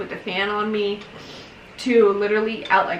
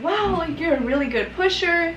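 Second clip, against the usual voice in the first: praising nurse, pitched higher; impressed nurse; 7 semitones higher than usual. Retold or imitated. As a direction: cheer her on like the nurse, higher. Nurse praising her pushing.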